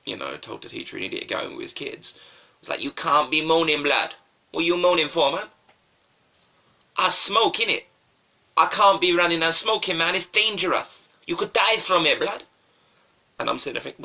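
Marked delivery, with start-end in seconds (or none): 2.8-12.4 raised-voice schoolyard complaint; other schoolboy. Retold or imitated. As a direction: protest loudly at your mate like an indignant lad. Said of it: "And the other boy ... was like".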